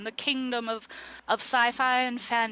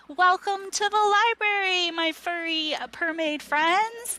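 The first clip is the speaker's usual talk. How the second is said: high, loud, eager greeter; put-on chirpy host voice, pitched well up; Young Poems; 8 semitones higher, 4 dB louder than usual. Young book welcoming visitors to the library, eager. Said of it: "turns back to you and says".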